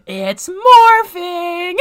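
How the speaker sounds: high, loud, very slow stretched cry; hammed-up funny voice. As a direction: drag out morphing in awe. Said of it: "He had the funniest voice".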